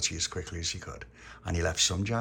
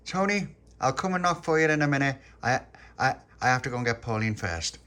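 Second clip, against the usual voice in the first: eager little character, a touch higher and louder; lightly voiced Little Pappy; 6 semitones higher, 5 dB louder than usual. A narrator's reading. Little Pappy at Tony's door putting him off, lightly voiced. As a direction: lightly act out an excited friend.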